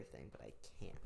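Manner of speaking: whispered aside; whispering. Real, not acted. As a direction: drop to a whisper. Muttered aside.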